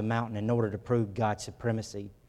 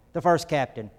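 ordinary talk

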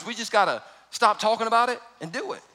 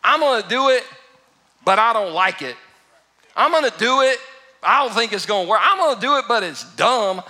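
loud, higher grudging Peter; mock-whiny reluctant fisherman griping; 4 semitones higher, 5 dB louder than usual. Peter grumbling to Jesus while obeying, loud and higher. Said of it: "This is like".

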